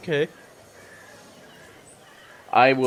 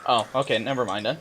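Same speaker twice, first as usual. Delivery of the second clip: ordinary talk, nothing marked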